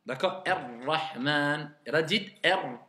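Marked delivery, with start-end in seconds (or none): 0.5-1.7 "Ar-Rahman" slow, light roll on the r; slow recitation. Shown as a demonstration. Teacher modelling Ar-Rahman slowly for pupils. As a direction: draw out "Ar-Rahman" gently. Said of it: "vraiment très léger".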